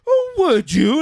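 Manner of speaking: high sneering "would you"; mock sarcastic officer. Sergeant mocking a soldier, high and sneering.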